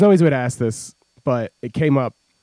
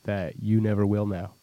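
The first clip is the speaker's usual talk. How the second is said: slow, soft, heavy with meaning; mock deep sincerity; 7 semitones lower, 6 dB quieter than usual. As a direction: play it like a solemn heart-to-heart. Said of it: "It's deep".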